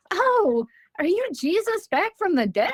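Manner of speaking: high, amazed question breaking into a laugh. Retold or imitated. Child meeting the creature asks, high and laughing. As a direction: gasp it out in wide-eyed wonder, laughing. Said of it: "and I'm like".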